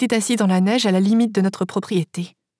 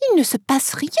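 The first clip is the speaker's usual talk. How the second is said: Ruth's comment; 6 semitones higher than usual. A narrator's reading. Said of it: "commenta Ruth".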